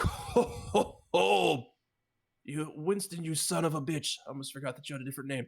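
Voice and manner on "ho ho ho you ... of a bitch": loud booming dwarf voice; magically amplified voice. Acted. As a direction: boom it out across the room. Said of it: "you hear this loud, booming voice".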